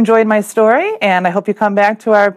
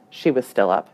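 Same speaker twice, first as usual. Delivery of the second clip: plain reading voice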